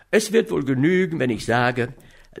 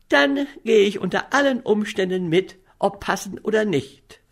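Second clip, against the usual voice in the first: eager and higher, full of resolve; a lightly voiced young woman's noble enthusiasm; 7 semitones higher than usual. A narrator's reading. Young woman vowing to go along, with noble enthusiasm. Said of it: "erwiderte sie mit edler Begeisterung".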